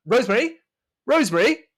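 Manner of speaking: high, loud call through the house. Acted. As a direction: call for someone in another room. Butler calls out to maid.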